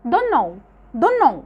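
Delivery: don't know said as "donno" with no T; casual American don't know; t dropped. Shown as a demonstration. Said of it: "مش بنطقها".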